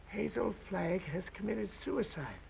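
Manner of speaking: quiet, slow and somber; hushed grim news. Acted. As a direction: drop the voice, deliver bad news. Grim news delivered low.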